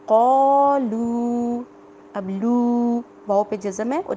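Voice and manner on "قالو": قالو with the final و stretched long; drawn out long vowel. Shown as a demonstration. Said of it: "اس کی example کیا تھی؟".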